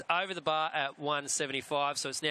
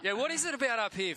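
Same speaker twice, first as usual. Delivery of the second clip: much higher, fast, through laughter; laughing; 8 semitones higher, faster than usual. Real, not acted. Host laughing as the interview line breaks up, amused. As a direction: giggle it out quickly.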